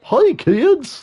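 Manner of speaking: put-on very high, goofy kids'-show host; hammed-up high mascot voice; Barney. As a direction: call to the children like a dinosaur mascot. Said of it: "Is that what Barney sounded like?".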